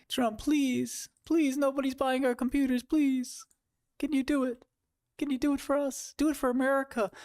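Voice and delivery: very high whiny plea; hammed-up pleading to Trump; begging CEO. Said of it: "CEOs on TV begging".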